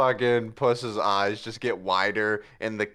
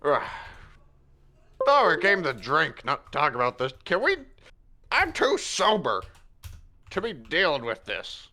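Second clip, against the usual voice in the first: exasperated grumble; 3 semitones higher than usual. Acted. Gaffrin exasperated.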